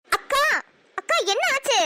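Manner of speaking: calling for attention. Acted. Younger sister rousing her distracted elder sister, calling insistently. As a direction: break into her daydream.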